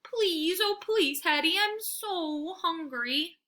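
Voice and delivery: high, slow whimpering beg; a hungry dog pleading pitifully; whining and moaning for food; whimpering plea. A narrator's reading. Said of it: "I whimper and moan".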